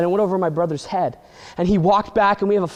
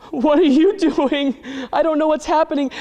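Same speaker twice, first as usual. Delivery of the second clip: panicked; 9 semitones higher than usual. Acted. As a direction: yelp it out in panic. Ben panics at levitating dog.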